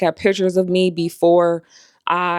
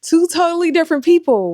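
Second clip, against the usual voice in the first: voice breaking; 10 semitones higher, 3 dB louder than usual. Real, not acted. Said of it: "this is making me emotional".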